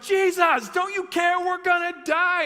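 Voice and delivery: high, loud, hammed-up desperate shout; acted panic. Disciples plead with sleeping Jesus, freaking out. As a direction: yell it like you're about to drown. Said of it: "Like, they're freaking out".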